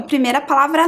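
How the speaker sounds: ordinary talk, nothing marked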